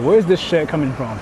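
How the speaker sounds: ordinary talk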